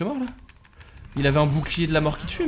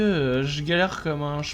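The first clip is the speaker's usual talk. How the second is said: ordinary talk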